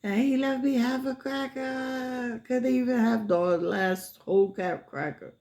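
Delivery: fake-cry it like a wronged child; mock crying. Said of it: "And she was like".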